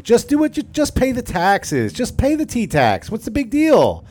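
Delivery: high, whiny, slow nagging voice; mock conformist. Imitating the compliant majority nagging the colonists. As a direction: nag everyone to just comply. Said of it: "That was eighty-seven percent".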